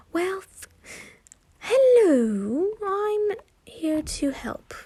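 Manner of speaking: a hint of a Scottish brogue, drawn out high; Scottish accent. A narrator's reading. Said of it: "said an unfamiliar Scottish, um, unfamiliar voice".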